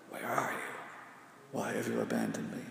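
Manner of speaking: faint desperate whisper to the Father; hushed cry. Retold or imitated. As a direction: breathe the words like a dying man. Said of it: "crying out to the Father".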